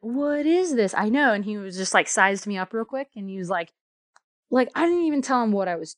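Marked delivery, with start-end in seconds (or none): 0.0-0.9 higher, mildly baffled quote; mock-baffled question; retold bewilderment. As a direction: act out mild astonishment at an odd sight. Retold astonishment at cluttered house.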